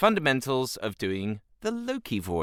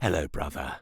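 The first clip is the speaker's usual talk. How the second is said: lower, raspy "Hello, brother"; low raspy Loki; 7 semitones lower than usual. Shown as a demonstration. Loki greeting his brother in a lower rasp. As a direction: greet him low and rasping. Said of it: "enunciate the rasp, but also low".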